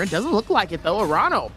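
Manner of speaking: ordinary talk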